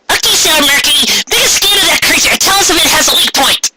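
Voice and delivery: put-on high girlish commanding voice; the team leader taking charge; heroine giving orders. Leader to the tech girl in battle: high girlish command.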